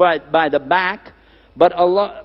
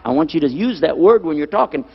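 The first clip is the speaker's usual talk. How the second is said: ordinary talk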